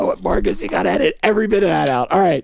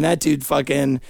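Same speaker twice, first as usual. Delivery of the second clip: usual speaking voice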